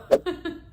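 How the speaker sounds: short rueful laugh. Real, not acted. After the doctor gives up, a rueful laugh. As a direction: bursts out laughing in disbelief.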